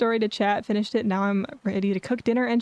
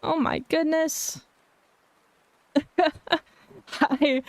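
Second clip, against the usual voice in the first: higher, slow, drawn-out surprise; cute anime streamer; 6 semitones higher, much slower than usual. Real, not acted. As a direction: stretch each word in happy shock. Sudden raid arrives on stream.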